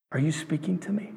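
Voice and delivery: soft whisper to God; barely voiced, reverent asking; hushed prayer. Shown as a demonstration. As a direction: whisper it as a tentative question.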